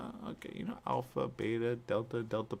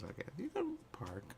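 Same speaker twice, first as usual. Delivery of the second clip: whisper; 7 semitones higher, 10 dB quieter than usual. Retold or imitated. Whispered line in retold talk.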